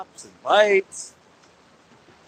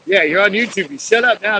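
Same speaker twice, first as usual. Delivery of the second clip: mock scolding; 4 semitones higher, 4 dB louder than usual. Talking back at barking sea lions.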